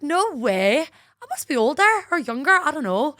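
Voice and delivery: high, loud Northern Irish lilt; put-on Lurgan accent. Bluffing to boyfriend's friends, put-on Lurgan accent. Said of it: "you're from Lurgan".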